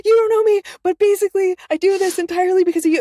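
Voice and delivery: very high, loud, breathless-fan babble; gushing fangirl. Retold or imitated. Fan rushing up to her favorite narrator, gushing. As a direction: gush in a high squeal, acting out your starstruck self.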